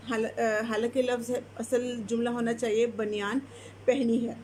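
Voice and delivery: ordinary talk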